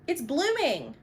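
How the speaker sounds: high, thrilled announcement; excited surprise. Real, not acted. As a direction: gasp it out, delighted. Discovers a bloom.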